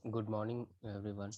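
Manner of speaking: very soft, barely heard; barely audible. Real, not acted. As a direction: speak so quietly it barely carries. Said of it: "Your voice is very low".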